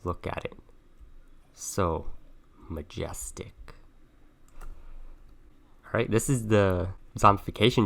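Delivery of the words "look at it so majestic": hushed wildlife narrator, whispered awe; soft nature-show voice; nature documentary. Pretend. Wild bob in natural habitat.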